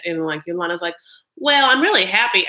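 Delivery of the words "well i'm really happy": attempted East Coast accent, higher and louder; badly faked East Coast accent; Yolanda. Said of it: "I can't really do her accent".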